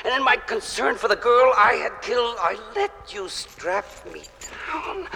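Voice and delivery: high, impassioned reproach; anguished accusation. Acted. Monster reproaching Frankenstein for not understanding him, anguished accusation. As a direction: build from pleading into high-pitched anguish.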